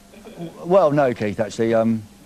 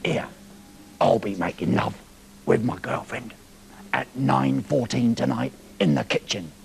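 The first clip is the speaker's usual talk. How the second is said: low, hushed voice of a pervert caller; mock pervert on the phone; 8 semitones lower, 7 dB quieter than usual. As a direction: play the seedy phone caller, low and soft. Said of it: "phoned us up, the pervert, and said".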